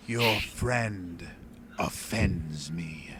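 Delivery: slow, imperious monster voice; haughty monster listing offences; offended creature. Acted. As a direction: draw out each grievance with slow disdain. Creature's reply.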